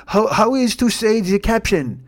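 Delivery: put-on slow, lightly accented French model; halting French-accented English. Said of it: "he's just like".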